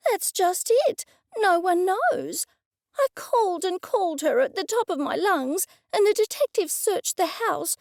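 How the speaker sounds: put-on high, anxious older lady voice; high worried woman. A narrator's reading. Cressida distraught, detectives searching.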